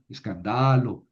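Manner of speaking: escándalo said as escanDAlo, accent on DA; stress moved forward. Shown as a demonstration. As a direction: lengthen the DA in escándalo. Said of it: "tengo que mover el acento".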